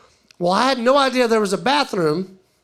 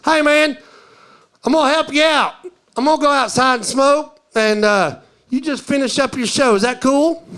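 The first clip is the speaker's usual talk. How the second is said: high, loud drawn-up holler; imitated bar patron, loud and raised; one-eyed patron calling out; 4 semitones higher, 4 dB louder than usual. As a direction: holler an offer across the bar. One-eyed man stands, calls out.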